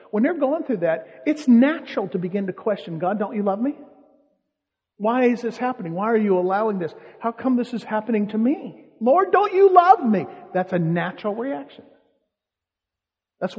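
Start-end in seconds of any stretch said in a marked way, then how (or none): 3.0-10.3 rising, pleading cry to God, loudest at the end; a plea that builds to a high, loud cry; anguished plea. Acted. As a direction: beg God like someone suffering. Voicing anguished believer's plea.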